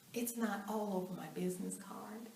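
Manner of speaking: soft unvoiced words; whispered confidence. Real, not acted. Reassuring listeners about her card, in a whisper. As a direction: share it like a secret.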